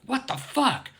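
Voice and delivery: high, startled what the fuck; shocked outburst. Retold or imitated. Telling about the chef, a shocked cry at the cruelty. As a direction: blurt it out in disbelief. Shocked at live crab butchery.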